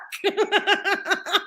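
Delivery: high-pitched laugh. Real, not acted. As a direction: laugh at your own story. Laughs at own retort.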